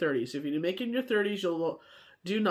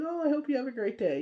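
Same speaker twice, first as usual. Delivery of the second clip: put-on higher, gentle voice for a little kid; sweet to a child; 7 semitones higher than usual. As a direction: talk sweetly down to a small child. She answers the kid sweetly.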